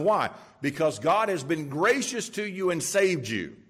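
usual speaking voice

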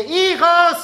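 llamada fuerte: "Hijos"; papá llamando. Retold or imitated. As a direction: grita a los hijos para que vengan. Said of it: "papá dice". (padre que llama a sus hijos en voz alta)

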